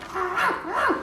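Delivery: high, yappy small-dog barks; puny pup putting up a big front; tough little dog. Acted. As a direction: yap like a tiny dog acting tough. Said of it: "he always acts really tough".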